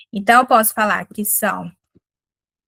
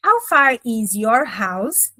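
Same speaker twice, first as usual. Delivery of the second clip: slow teaching example; much slower than usual. Shown as a demonstration. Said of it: "por exemplo, aqui, ó".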